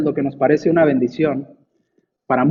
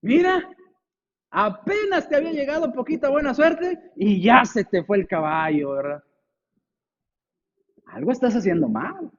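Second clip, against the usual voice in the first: mocking neighbours; 5 semitones higher, 3 dB quieter than usual. Neighbours mocking the old man.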